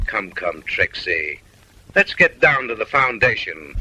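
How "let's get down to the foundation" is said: ordinary talk, nothing marked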